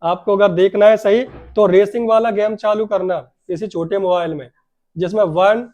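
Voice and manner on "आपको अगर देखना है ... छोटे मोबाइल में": ordinary delivery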